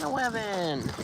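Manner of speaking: put-on high sing-song baby talk. Shown as a demonstration. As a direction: coo it like a toddler. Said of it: "I wanna say N eleven in, like, a baby voice".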